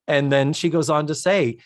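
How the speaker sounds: normal talking voice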